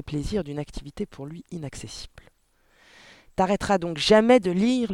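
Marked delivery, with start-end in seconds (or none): 3.4-4.9 raised, higher, irritated outburst; angry father. A narrator's reading. Father snapping at his daughter over her reading, angry. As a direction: throw it out at the child, loud and exasperated. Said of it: "lui lança-t-il".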